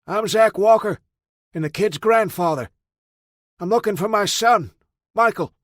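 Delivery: loud, high call across the distance; father calls out his name to the armed old man. A narrator's reading. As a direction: shout it to someone far off. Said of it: "called out".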